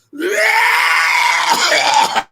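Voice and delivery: high, loud whooping yell from the back; a heckler's shrieking whoop; wild shout. Retold or imitated. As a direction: let out a sudden crazed holler.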